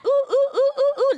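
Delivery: high, loud mock oohs; mock showing-off coos at followers; mock cooing. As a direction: coo over an outfit playfully.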